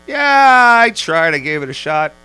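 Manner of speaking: a burst of high, loud pride, then settling down; mock proud answer. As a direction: boast it, then ease off. Retelling proud answer to question.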